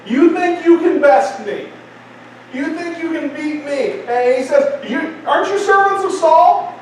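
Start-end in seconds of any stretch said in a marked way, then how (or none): 0.0-4.0 high-pitched, cocky challenge; mock Goliath talking smack; taunting battle cry. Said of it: "he cries out". 4.9-6.8 raised-pitch, indignant challenge; boastful warrior calling out; taunting Goliath. Acted. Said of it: "he begins to talk smack".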